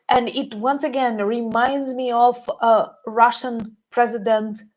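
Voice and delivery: usual speaking voice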